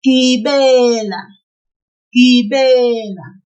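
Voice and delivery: loud, slow Gibela with a long "be"; pulled second syllable. Shown as a demonstration. Teacher modelling for learners to repeat, Gibela loud with "be" drawn out. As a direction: project Gibela and stretch its middle syllable "be". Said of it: "we will pull the second syllable".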